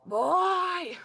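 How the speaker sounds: loud, high, drawn-out yell; strained father's yell. Acted. Game master voices father yelling for Cecil, strained yell. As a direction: yell for your son like a strained old father. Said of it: "strained, garbled voice of your father just yelling".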